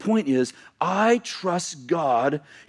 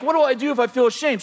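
high, quick question; acted anxious question; 9 semitones higher, faster than usual. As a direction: ask it fast and high. Acted worried question to God.

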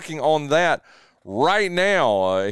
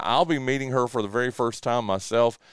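usual speaking voice

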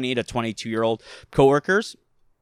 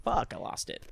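muttered dismay; 7 semitones higher, 8 dB quieter than usual. Retold or imitated. Losing his accent, self-quote.

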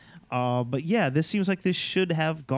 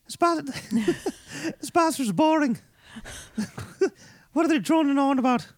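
put-on bored kid; 11 semitones higher than usual. Voicing bored schoolkids.

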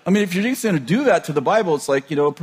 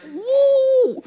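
high, drawn-out "woo"; long high-pitched whoop; mock woo. Preacher mimicking people sifting who's in, high mock woo. Mimics reaction to ever finer screening.

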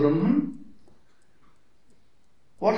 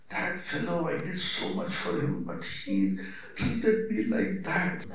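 much softer, slowing aggrieved grumble; a wounded sigh of a let-down friend; hurt complaint; 7 dB quieter than usual. Retold or imitated. Hurt person's complaint quoted.

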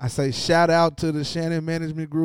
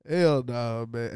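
ordinary talk

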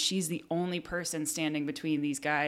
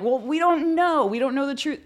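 mocking heckler voice; 11 semitones higher, 6 dB louder than usual. Imitating hecklers dodging her challenge, high mocking voice. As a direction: play the hecklers as clueless and whiny, high up. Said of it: "And they're like".

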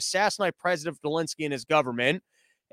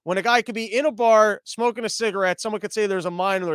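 normal talking voice

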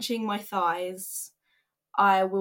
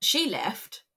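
ordinary delivery

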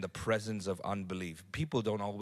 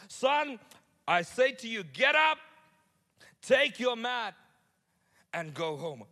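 high, loud, slow command; commanding Jesus; 11 semitones higher, 7 dB louder than usual. Acted. Jesus commanding the lying man, loud solemn command. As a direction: proclaim each word like an order.

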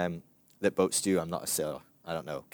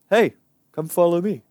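casual, higher, louder call; regular-guy Jesus; 7 semitones higher, 7 dB louder than usual. Acted. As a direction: toss out a quick, everyday invitation. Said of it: "just some regular, normal".

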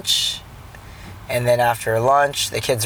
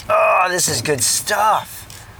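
high, slow, drawn-out enthusiasm; relishing delight; 7 semitones higher, much slower than usual. Real, not acted. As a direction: savour it, stretching the words. Relishing the family's hospitality.

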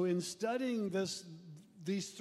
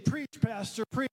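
raised, loud mock cheering; fired-up church member; 5 dB louder than usual. Eager front-row member urging on the pastor, fired up. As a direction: egg the preacher on loudly. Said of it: "he's saying".

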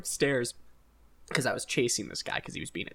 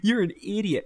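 put-on higher, teasing jab at a drunk friend; mock mocking friend; 7 semitones higher, 3 dB louder than usual. Austin teasing him for not getting in the Uber, higher mocking voice. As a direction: rib him like a buddy giving him shit. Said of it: "he starts giving me shit".

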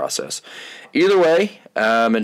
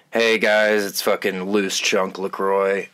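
ordinary delivery

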